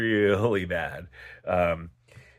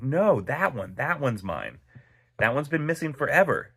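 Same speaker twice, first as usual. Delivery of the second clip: surprised protest; 7 semitones higher than usual. Retold or imitated. Spots his lost knife.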